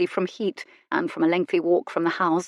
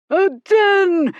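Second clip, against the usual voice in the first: much higher, louder outraged roar; 13 semitones higher, 8 dB louder than usual. A narrator's reading. Goodkind roaring at Miss Brightly in the garden, outraged shout. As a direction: bellow it to the sky. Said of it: "he roared to her".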